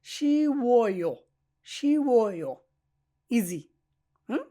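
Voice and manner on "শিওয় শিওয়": শিওয় drawn out slowly as "shi-wo-yo"; teacher sounding out শিওয়, each vowel stretched; demonstrated pronunciation. Shown as a demonstration. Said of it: "তাহলে কি হবে?".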